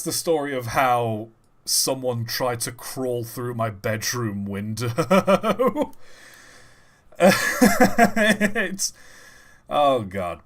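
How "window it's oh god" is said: slow, words breaking into laughter; real laughter. Real, not acted. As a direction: crack up on the last word. Breaks into laughter ending story.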